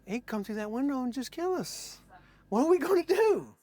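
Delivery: high, hushed panicky voice; mock panic. Trapped bandmates fearing the knife man, acted high panic. Frightened guests watching the window.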